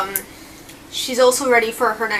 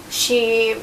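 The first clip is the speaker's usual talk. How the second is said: ordinary delivery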